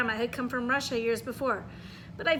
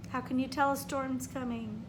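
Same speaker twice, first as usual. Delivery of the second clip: ordinary delivery, nothing marked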